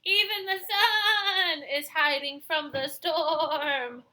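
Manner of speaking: high, quavering lament; scared sheep wailing; fearful. Acted.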